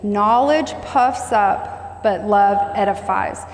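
each word stretched out, deliberate; slow repeat-after-me. Shown as a demonstration. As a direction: lead the congregation line by line. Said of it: "Say that with me".

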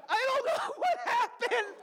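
high shouting through laughter; panicked laughing shout. Retold or imitated. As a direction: hysterical half-laugh. Panicked after seeing hand.

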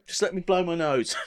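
ordinary talk, nothing marked

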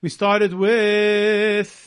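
"started with" stretched very slowly, teasing; mock slow echo. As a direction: drag it out to tease the cohost. Mocking echo of cohost.

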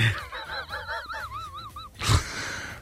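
high squealing laugh of embarrassment; cringing laugh; helpless laughter. Real, not acted. Dad embarrassing her at counter.

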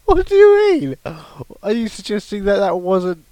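high, loud mock outrage settling down; mock indignant. Co-host defending his impression, mock indignant. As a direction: play the wounded performer, voice shooting up. Mock offence at being doubted.

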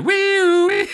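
very high, loud imitated police siren; high siren sound; siren noise. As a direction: wail like a cop car siren. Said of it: "every cruiser in Boston just like".

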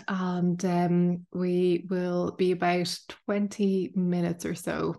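ordinary talk, nothing marked

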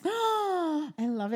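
high, slow gushing delight. Real, not acted. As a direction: gush about it. Excited gasp of delight.

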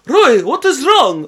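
mock Dracula mother calling up the stairs; mum's spooky voice. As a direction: call it out slow and eerie like a vampire mum. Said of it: "my mum's apparently Dracula".

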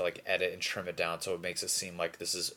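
ordinary talk, nothing marked

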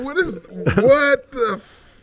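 slow, loud stunned exclamation; shocked. Real, not acted. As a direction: drag it out in disbelief. Shocked, drawn out.

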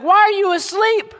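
the captain calling out high and loud, shaking a sleeper awake; a panicked captain yelling at a sleeping man; alarmed ship captain. Acted. Captain wakes Jonah in storm.

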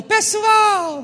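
high, loud call across the cinema; mock shout from the pastor; shout to the room. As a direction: yell out to get everyone's attention. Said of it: "gritou lá dentro do cinema".

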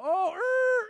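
startled gasp. Retold or imitated. People react to hearing "pastors.

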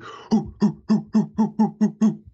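string of short high-pitched hoots; imitated gang hooting. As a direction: hoot in quick, even bursts like a rowdy gang. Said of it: "they followed up with".

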